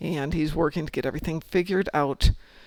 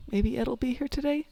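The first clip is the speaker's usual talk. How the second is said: much higher, hopeful wondering; higher hopeful repeat; 8 semitones higher, 4 dB quieter than usual. Acted. Player repeating a daily wish, higher and hopeful. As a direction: lift the whole line with rising hope. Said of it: "Every day I get up and it's like".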